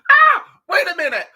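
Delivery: high, loud burst of realisation; excited shout. Real, not acted. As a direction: blurt it out, suddenly struck. Sudden realisation mid-story.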